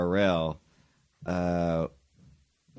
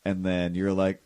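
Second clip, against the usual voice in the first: ordinary talk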